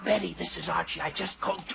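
low, strained hoarse voice; laryngitis. Acted. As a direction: push a weak voice down the phone. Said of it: "he has laryngitis".

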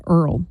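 Earl for oil; grandfather's pronunciation. Retold or imitated. Host quotes her grandfather, oil said as Earl. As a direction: turn oil into Earl, with an er vowel. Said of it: "My grandfather said Earl".

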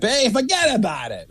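Sopranos-style New York "Hey, forget about it."; mock New York wiseguy line; New York accent. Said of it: "good New York accent".

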